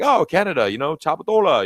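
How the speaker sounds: lightly voiced cheery local recognising a Canadian; imitating Chinese locals. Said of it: "they'll be like".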